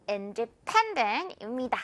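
independent with pen hit hard, very slow; slow, lean on pen; stress on pen. Shown as a demonstration. Teacher modelling the stressed third syllable, slow. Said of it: "세 번째 음절에 강하게".

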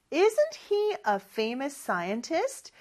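very slow rising question; slow model question. Shown as a demonstration. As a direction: model it slowly. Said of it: "scientist가 과학자라는 뜻이죠". (아주 천천히 올리는 시범)

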